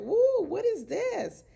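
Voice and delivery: high, loud, slow shocked "woo"; shocked exclamation. Retold or imitated. Vlogger reacting to the mama's demand, shocked and slow. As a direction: pitch way up and drag it out in disbelief. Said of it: "I was like".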